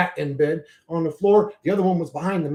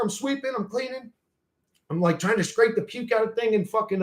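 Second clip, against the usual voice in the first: ordinary talk, nothing marked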